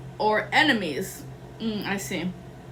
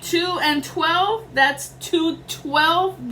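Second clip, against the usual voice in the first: slow emphatic count; 5 semitones higher, much slower than usual. Real, not acted. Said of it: "That's the law of rhythm".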